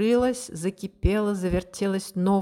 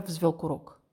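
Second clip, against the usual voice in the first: usual speaking voice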